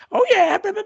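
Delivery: high, loud, silly mimic of the wife babbling; mock wife chatter. Husband mimicking his wife gabbling on, high and loud. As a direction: squeak out the blah blahs like a gabbling wife.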